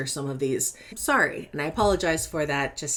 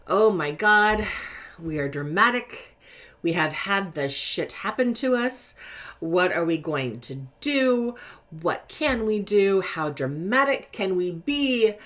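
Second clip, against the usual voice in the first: tongue-in-cheek wallowing; 3 semitones higher than usual. Acted. Deck wallowing in its misfortune, tongue-in-cheek drama. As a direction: play mock despair, stretching the key words. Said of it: "that tongue-in-cheek version, kind of, of wallowing".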